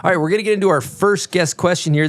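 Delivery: usual speaking voice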